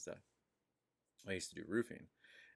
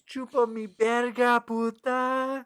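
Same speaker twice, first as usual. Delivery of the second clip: shouted, much higher and louder; loud confident yell; 12 dB louder, slower than usual. Retold or imitated. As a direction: bellow it proudly across the site.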